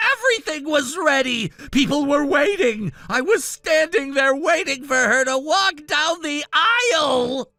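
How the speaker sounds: shouting the list of ruined things; a groom raging that the wedding is ruined; angry rant. Acted. Groom ranting about the ruined wedding.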